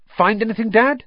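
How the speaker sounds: put-on high, loud, eager boy. A narrator's reading. Son to his father home from raids, eager question. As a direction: ask it keenly, pitched right up. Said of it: "said Fred eagerly".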